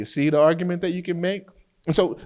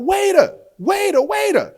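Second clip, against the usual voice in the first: high, loud call for the waiter; yelled call; 5 dB louder than usual. Retold or imitated. Husband yelling for the waiter about the fly, loud high call. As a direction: shout across the restaurant for the waiter. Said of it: "So I yelled out".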